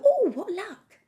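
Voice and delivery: very high, loud gleeful exclamation; exaggerated, swooping cry of joy; mock delight. Mock quote of grabbing luck.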